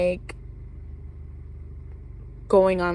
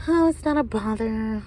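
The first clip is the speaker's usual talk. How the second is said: put-on politeness; 3 semitones higher than usual. Texting back friend's boyfriend though bothered, falsely polite. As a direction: cover the annoyance with polite reassurance.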